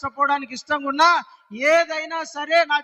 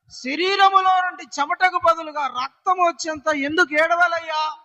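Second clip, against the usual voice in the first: fervent pleading sermon voice; impassioned preaching; 2 semitones higher than usual. Acted. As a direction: plead with the Lord, asking why he weeps. Pleading questions to the Lord.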